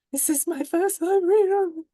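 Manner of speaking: sharp gasp, then a high, loud, nervous line; real first-time jitters breaking through; gasping with stage fright before reading. Real, not acted. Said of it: "No, I'm not panicking at all".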